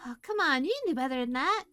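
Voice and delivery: ordinary delivery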